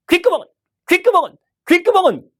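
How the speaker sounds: 귓구멍은 called out high and loud, falling each time; building call. Shown as a demonstration. 승객들 관심 끌려고 귓구멍은을 외치는 잡상인. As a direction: repeat 귓구멍은 as a rising hawker's cry. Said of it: "자신 있게 외칩니다".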